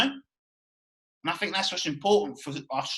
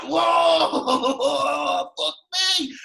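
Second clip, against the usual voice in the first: loud, higher gasp of awe with a laugh; amazed crowd shout; 9 semitones higher, 6 dB louder, much slower than usual. Retold or imitated. Gym crowd reacting to a huge bodybuilder, amazed shout. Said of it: "And everyone's like".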